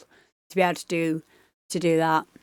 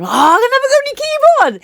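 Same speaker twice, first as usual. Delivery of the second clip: mocking squeaky voice; 3 dB louder, much faster than usual. Mocking himself at the party.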